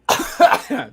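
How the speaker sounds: sharp choking cough. Real, not acted. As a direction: cough hard on a drink. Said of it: "I'm really choking".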